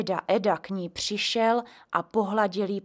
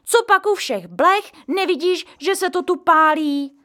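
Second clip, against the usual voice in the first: angry; 9 semitones higher, 9 dB louder than usual. A narrator's reading. Mother scolds boy.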